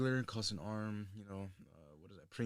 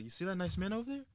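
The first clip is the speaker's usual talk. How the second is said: put-on higher, sly dad telling his kids; pitched-up dad voice, deadpan joke; mock dad voice; 9 semitones higher than usual. Dad to his kids, pitched-up mock voice.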